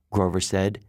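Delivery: ordinary delivery, nothing marked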